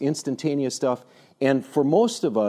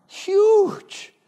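very high, loud burst; emphatic exclamation; 6 dB louder than usual. Real, not acted. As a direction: hurl the word out for huge emphasis. Emphatic exclamation about the car's impact.

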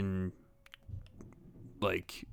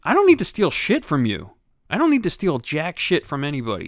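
angry retort; 5 semitones higher, 6 dB louder than usual. Retold or imitated. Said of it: "the fucking dude is like".

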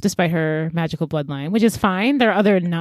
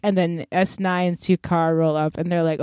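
ordinary talk, nothing marked

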